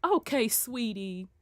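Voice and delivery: soft, slow Southern sweet-talk; Southern charm. Shown as a demonstration. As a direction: lay on gentle Southern honey. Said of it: "throw that Southern charm".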